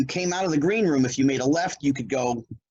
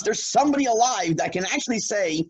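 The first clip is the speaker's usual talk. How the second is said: excited recognition; 8 semitones higher than usual. Retold or imitated. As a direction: burst out with joy at being remembered. Excited reply to lighting guy.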